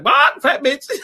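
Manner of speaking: high squawked insult; imitated talking bird. Pet bird in the back echoes the insult, squawking imitation. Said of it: "the bird in the back be like".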